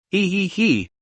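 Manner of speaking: soft sneaky giggle; quiet giggle. Acted. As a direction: giggle under your breath. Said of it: "whispered celebration".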